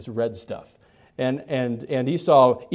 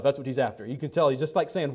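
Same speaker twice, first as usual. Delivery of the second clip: ordinary talk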